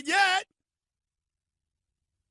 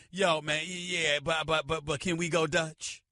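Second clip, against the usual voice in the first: lightly voiced Jabba, stalling nervously; Jabba the Hutt; 9 semitones lower, 4 dB quieter than usual. Jabba asking [name] to split lunch, lower stammer. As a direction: lower voice, hesitant mumbling stammer. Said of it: "and he's like".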